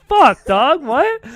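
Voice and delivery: high, loud burst of disbelief; loud shock. Retold or imitated. Friend retelling his reaction to Noah's swelling, loudly shocked. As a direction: blurt it out, stunned and way up in pitch.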